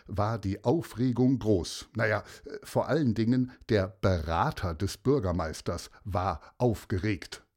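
ordinary delivery, nothing marked

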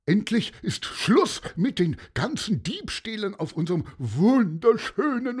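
high, pompous, hectoring vendor; stumbling, indignant accuser's voice; blustering candyfloss seller, accusing. A narrator's reading. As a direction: harangue the crowd, then grill the boy. Candyfloss seller harangues boy.

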